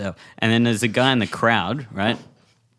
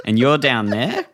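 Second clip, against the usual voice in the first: ordinary delivery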